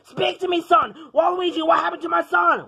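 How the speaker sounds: high, urgent cry over a son; frantic worry; alarmed father. Acted. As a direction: panic as you demand answers. Father finds son unresponsive.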